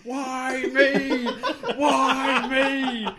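high, loud, hammed-up lament; drawn-out cartoonish despair; mock wailing. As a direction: imitate a man crying out at his fate. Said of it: "He's like".

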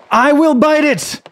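high, loud furious shout; angry cry. Acted. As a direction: scream it in rage. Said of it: "cried the man in great anger".

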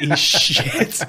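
loud, high blurted "He's shit"; dead loud. Retold or imitated. Tourette's girl calls out about the failing act, dead loud. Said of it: "But dead loud".

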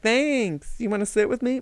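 lightly mocking, high-pitched girl; imitated naive girl. Acts out the girl answering maid.

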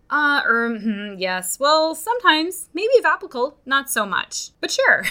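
mock the noncommittal reply, hemming and hawing; wishy-washy hedging. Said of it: "my answer sometimes is, sounds like this".

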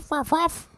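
high squeaky yips; mock barking. Imitating the barking dogs.

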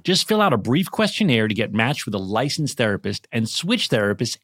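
emphatic, scripted sponsor pitch; ad read. Acted. Host sending listeners to a sponsor's website, emphatic ad read. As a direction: punch the key selling points.

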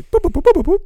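high, loud electronic boops; imitated pager boops. Host mimicking his booking pager, high boops. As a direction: pipe out quick pager tones. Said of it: "that's my pager".

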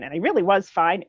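normal talking voice

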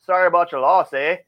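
mock-polite Canadian accent, teasing the losing fans; fake Canadian accent. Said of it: "in his thickest fake Canadian accent".

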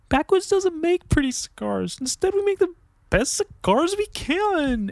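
high, defeated sad-sack reading; devastated tone. Shown as a demonstration. Rereading the package slogan in a devastating tone. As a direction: read it like a crushed apology. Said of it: "read it in the wrong tone".

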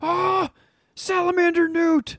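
high-pitched mock panicked yelp; mock fright. Teasing a friend who almost shit his pants on a run, mock fright. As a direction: squeal it in pretend alarm.

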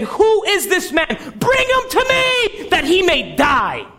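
David furious at the rich man in the parable; indignant king. Acted. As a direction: demand the culprit be brought to die. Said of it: "David got indignant and said".

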